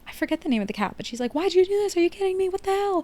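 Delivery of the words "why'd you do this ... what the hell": high-pitched panicked outburst, much higher; freaking out. Retold or imitated. Agnes freaking out at the cat over the mouse, high panicked yelps. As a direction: squeal it out in mock disbelief and panic. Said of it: "she's freaking out".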